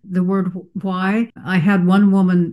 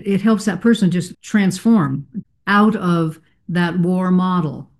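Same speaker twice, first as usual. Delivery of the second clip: ordinary talk, nothing marked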